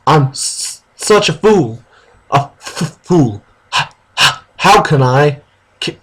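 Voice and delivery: stuttering, half-laughing fake cry; mock sobbing stammer. Reading the heroine's tearful confession, hammed-up. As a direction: stammer through fake tears. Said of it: "she started crying".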